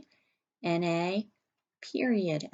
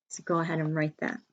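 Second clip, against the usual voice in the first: ordinary delivery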